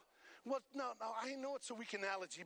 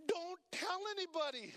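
Jesus's instruction; 8 semitones higher than usual. Retold or imitated. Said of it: "you know what Jesus tells him".

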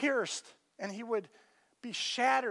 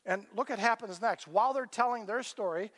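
ordinary delivery

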